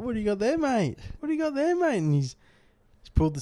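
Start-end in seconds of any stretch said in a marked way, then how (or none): 0.0-1.0 much higher, teasing bloke asking about the sandwich; mocking mate voice. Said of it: "He's like". 1.2-2.0 even higher squeaky repeat of the teasing question; mocking mate voice, pushed higher. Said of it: "He's like".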